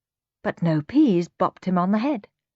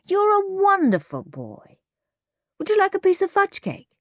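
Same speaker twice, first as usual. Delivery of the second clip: higher, sugary mother's voice; doting mum; 13 semitones higher, 3 dB louder than usual. A narrator's reading. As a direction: gush like a proud mother. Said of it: "mum praises Henry".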